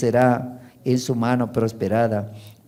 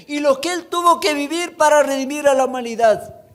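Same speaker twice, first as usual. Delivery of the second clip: full-force preaching; 11 semitones higher than usual. Acted. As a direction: preach it with fire to the congregation.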